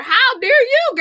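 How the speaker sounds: very high, loud hammed-up indignation; mock outrage. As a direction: scold God in playful fury. Mock outrage at God, laughing.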